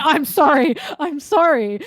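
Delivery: hammed-up repeated apology; mock apology. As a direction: apologize hastily as a scolded character, playfully. Acts Zoro's apology after a scolding.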